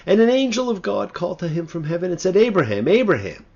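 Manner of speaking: loud, high proclaiming of the verse; declaiming scripture. Acted. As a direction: ring out the angel's call from heaven. Declaims scripture, double name calling.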